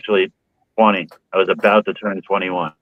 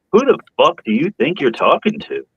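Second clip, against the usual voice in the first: retorting to the cop; 8 semitones higher than usual. Retold or imitated. Retold roadside argument with cop.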